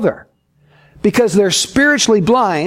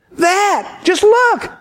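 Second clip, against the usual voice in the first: much higher, urgent pointing-out; excited insistence; 11 semitones higher, much slower than usual. Acted. Sightseer urging the blind man to look, insistent. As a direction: play an exasperated, thrilled sightseer.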